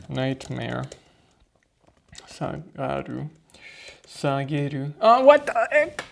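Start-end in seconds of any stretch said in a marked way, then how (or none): 5.0-6.0 exasperated surprise. Real, not acted. Surprised by the vocab card.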